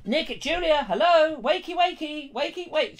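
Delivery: high, sing-song coaxing; wake-up call. Pretend. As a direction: try to rouse a sleeper with a high coo. Trying to wake sleeping Julia.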